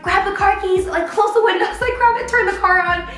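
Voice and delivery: high, frantic rushed commands; panicked orders. Retold or imitated. Telling the sister in front to start the car, panicked. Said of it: "a state of panic".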